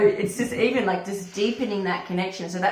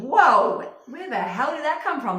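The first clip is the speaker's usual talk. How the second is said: higher, louder startled exclamation; shocked; 7 semitones higher, 4 dB louder, slower than usual. Retold or imitated. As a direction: caught off guard, loud. Recalls shock at inner voice.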